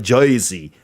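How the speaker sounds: "Jersey" said as "Joisey"; Jersey-accent pronunciation. Shown as a demonstration. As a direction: turn the first vowel of "Jersey" into "oi". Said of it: "they say it like Jersey".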